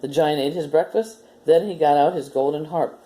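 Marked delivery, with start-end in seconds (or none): none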